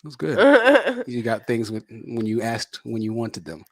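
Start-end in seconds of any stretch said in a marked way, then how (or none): 0.0-1.0 excited. Real, not acted. Reacting to spoiled child.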